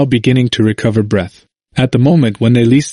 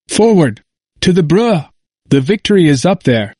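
higher-pitched rallying cry at narrator's volume; exclaimed battle command; 5 semitones higher than usual. A narrator's reading. Janivel to his men as he spots the trap, exclaiming. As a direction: exclaim it as a rousing order to advance. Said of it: "he exclaimed".

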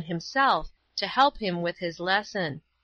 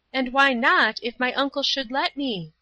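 put-on higher-pitched voice of a young girl; Paula; 6 semitones higher, 3 dB louder than usual. A narrator's reading. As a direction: lift the voice high for a girl's line.

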